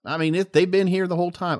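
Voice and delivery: put-on a flounder drawling in a high southern accent; southern fish voice. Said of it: "he turned southern".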